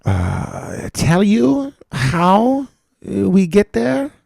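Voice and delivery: put-on high, loud German pilot accent; German captain on the intercom, slowly. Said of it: "captain was German".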